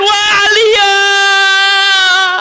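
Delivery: drawn-out wail. Acted. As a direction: wail the name slowly in grief. Women wailing over slain Ali. (ratapan panjang yang ditarik lambat)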